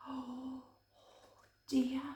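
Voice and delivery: soft mock fright; gasp and hush. Reader to child about the hungry bear, hushed. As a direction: gasp then say it quietly. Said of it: "gasp, hushed".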